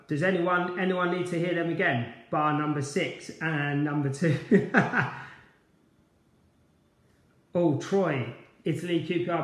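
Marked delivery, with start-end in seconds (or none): 4.4-5.3 a quick amused laugh; brief chuckle falling away; short laugh. Real, not acted. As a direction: laugh it off lightly. Laughs at own request.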